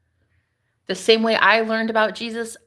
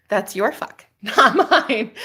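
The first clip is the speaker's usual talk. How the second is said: ordinary delivery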